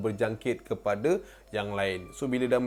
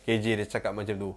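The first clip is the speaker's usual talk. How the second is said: ordinary delivery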